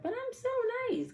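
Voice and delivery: very high, pleading "so nice"; mock innocent. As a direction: whine your own innocence up high. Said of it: "Then I was just like".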